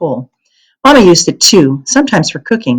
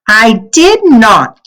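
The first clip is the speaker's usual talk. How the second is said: high, loud, slow indignant denial; indignant child; 6 semitones higher, 5 dB louder, much slower than usual. A narrator's reading. Kirsty protesting from the bedroom door, high and drawn out. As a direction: stretch it out like an offended little girl. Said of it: "Kirsty said haunt-, hauntingly".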